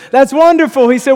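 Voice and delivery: elated high, loud shout; overjoyed. Acted. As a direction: shout up in joyful relief. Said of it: "And the man's elated, he's overjoyed".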